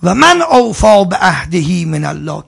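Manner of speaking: slow, loud chanted recitation; Quran recitation. Real, not acted. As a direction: intone the verse slowly and fully. (تلاوت آهنگین قرآن)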